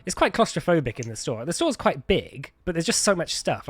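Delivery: usual speaking voice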